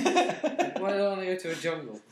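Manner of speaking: words pushed through laughter, slow at the end; amused, half laughing; laughing while talking. Real, not acted. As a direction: giggle through the joke. Jokes about being exiled woods.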